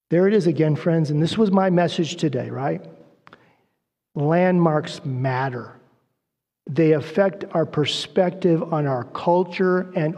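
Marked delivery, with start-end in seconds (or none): none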